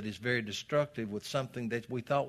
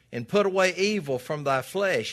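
scripture reading; 7 semitones higher, 8 dB louder than usual. Acted. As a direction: proclaim each phrase to the pews. Preacher quotes scripture.

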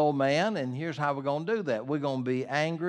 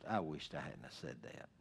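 much lower, hushed admission; quiet regret; 11 semitones lower, 15 dB quieter than usual. Acted. Preacher voices morning-after regret for words said, quietly rueful. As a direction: drop low and soft, regretting it.

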